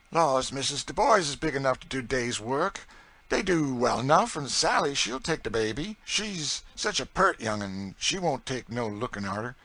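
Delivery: persuasive Southern cook. A narrator's reading. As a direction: talk the mistress round in Southern dialect. Narrator voices Chloe.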